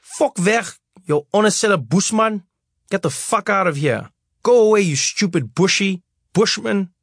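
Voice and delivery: cursing bully. Acted. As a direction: spit the slurs at the small boy. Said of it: "this kid started cursing me out".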